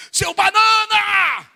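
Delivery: drawn-out stadium heckle; imitating dad's stadium yell. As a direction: yell at the referee like dad does at the Maracanã. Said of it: "às vezes se levanta e fala".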